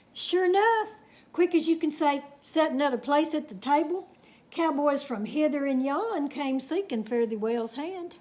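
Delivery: unhurried Texas tall-tale telling; story narration. A narrator's reading. Reading a cowboy tale to children, storytelling. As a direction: spin the yarn for the kids.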